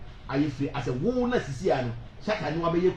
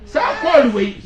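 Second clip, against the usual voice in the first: shout; 10 semitones higher, 11 dB louder than usual. Real, not acted. Calling out loudly, shouting.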